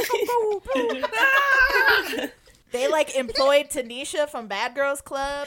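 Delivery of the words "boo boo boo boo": much higher, slow booming imitation; mock industrial noise. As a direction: boom out the breakdown noises. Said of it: "They're like".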